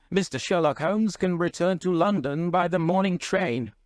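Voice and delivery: high-pitched, browbeaten headmaster. A narrator's reading. Browbeaten doctor offers to send the detective home, appeasing. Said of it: "said the browbeaten doctor".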